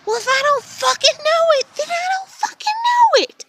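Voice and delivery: high, loud angry outburst; furious shout. Real, not acted. Venting at her family, shouting in real anger. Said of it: "outburst at family".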